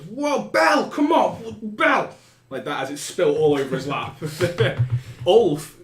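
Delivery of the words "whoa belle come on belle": high, loud yelp of protest; startled yelp. Acted. Blonde man yelping as drink spills on him, high loud protest. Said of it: "just goes".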